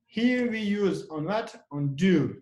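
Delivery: normal talking voice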